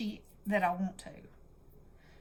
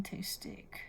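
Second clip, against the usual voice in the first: whispering; 12 dB quieter than usual. Real, not acted. Whispering while placing a page.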